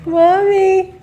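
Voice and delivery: high, loud cooing at a pet. Real, not acted. Cooing at the dog.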